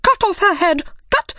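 high, furious royal order; angry shout. A narrator's reading. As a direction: shout the order as an enraged Queen. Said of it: "looked at Alice and shouted".

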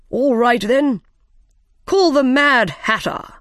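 high, loud royal call; summoning King. A narrator's reading. King calling the first witness into court, loud summons.

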